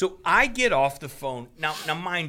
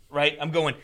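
usual speaking voice

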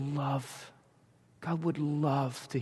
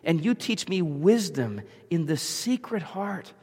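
raised, earnest pleading to God; fervent prayer voice; 6 semitones higher, 4 dB louder than usual. Acted. Preacher voicing David's prayer, fervent and raised. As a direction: preach the psalm as a heartfelt prayer.

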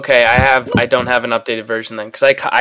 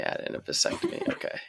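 breathy stage whisper; 17 dB quieter than usual. Shown as a demonstration. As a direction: whisper the line softly. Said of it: "you have a vasectomy whisper".

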